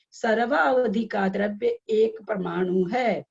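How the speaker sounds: slow, deliberate read-aloud. Shown as a demonstration. Teacher reading the text to attentive students, slowly. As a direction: read it out slowly so everyone follows. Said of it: "मैं बहुत धीरे धीरे पढ़ रही हूँ".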